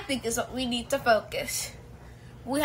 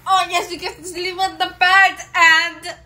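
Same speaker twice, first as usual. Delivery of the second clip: loud, high, thrilled exclaim; excited; 7 semitones higher, 8 dB louder than usual. Acted. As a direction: cheer it like great news. Thrilled about sleeping on bed.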